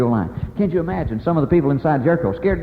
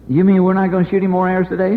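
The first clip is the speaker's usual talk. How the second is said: incredulous; 6 semitones higher, 5 dB louder than usual. Retold or imitated. Soldier questions Joshua, incredulous.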